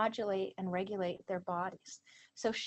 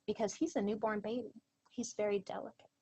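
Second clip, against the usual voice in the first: usual speaking voice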